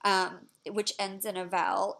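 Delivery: normal talking voice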